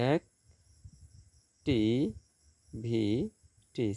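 "এক, টি, ভি, টি" as four separate beats; syllable by syllable. Shown as a demonstration. Teacher counting syllables for students, "এক, টি, ভি, টি" syllable by syllable. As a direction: break "এক, টি, ভি, টি" apart with long gaps. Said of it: "চারটি syllable".

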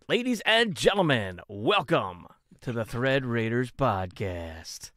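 swooping, drawn-out announcer welcome; show announcer. Real, not acted. Host formally opens the podcast to listeners, grand announcer delivery. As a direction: open the show like a ring announcer, stretching the welcome.

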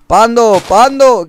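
high, loud call to the pet; a player hollering after his wandering panda. Real, not acted. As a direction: shout the name so it comes running.

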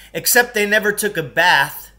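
put-on a kid blurting a rude fact at the teacher; blurted out. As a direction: call it out over the lesson. Blurts out in class.

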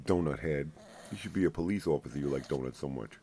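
ordinary delivery

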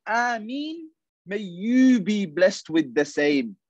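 high, slow and gentle blessing; angelic blessing. Retold or imitated. Angel answering a prayer for a friend, high slow gentle.

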